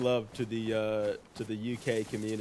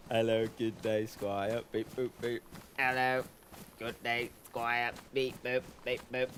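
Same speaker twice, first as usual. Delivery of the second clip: British robot. Acted. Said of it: "Best British brain rot".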